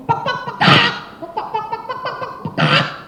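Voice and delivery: very high clucking pok calls; rooster crow. Acted. Rooster calls up the sun with high pok calls.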